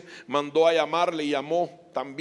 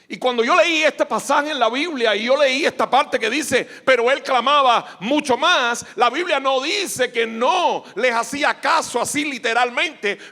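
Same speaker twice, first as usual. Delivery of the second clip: loud, raised preaching voice; 7 semitones higher, 8 dB louder than usual. Real, not acted. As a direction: drive the point home to the congregation. Preaches at full force.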